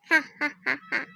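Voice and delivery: spaced-out mock "ha ha ha ha"; acted gloating laugh. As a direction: laugh triumphantly in separate beats. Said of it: "और तुम ऐसे क्यों हंस रही हो?". (बनावटी विजयी हँसी, रुक-रुक कर)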